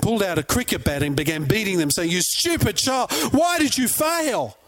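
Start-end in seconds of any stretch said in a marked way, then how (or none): 2.1-4.6 high, slow, hammed-up scolding; mock disappointed fury. Parent berating a toddler who fell, hammed-up high-pitched anger. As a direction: drag out an exaggerated parental telling-off. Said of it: "looked at them with disappointment".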